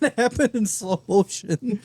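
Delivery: high-pitched, talking through laughter; laughing. Real, not acted. Joking with friends about a mishap, laughing. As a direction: crack up while getting the words out.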